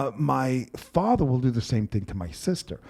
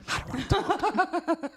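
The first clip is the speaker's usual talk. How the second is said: higher, laughing imitation of his mother; mom imitation. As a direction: pitch it up high and laugh through it. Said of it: "I hear her".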